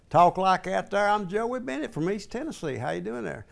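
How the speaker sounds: put-on East Tennessee hillbilly accent. Hillbilly introducing himself to the viewers, East Tennessee accent. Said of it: "originally a hillbilly".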